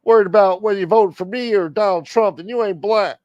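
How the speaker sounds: high, loud mock old-politician voice, voice pushed way up; Joe Biden impression. Host mocking Biden's campaign line, loud high parody. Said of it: "It's like when Joe Biden said".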